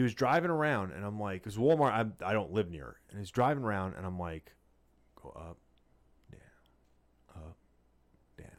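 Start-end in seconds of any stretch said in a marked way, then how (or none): 5.2-8.5 hushed go up, down; murmured under the breath; whispered directions. Retold or imitated. Mimicking parking directions.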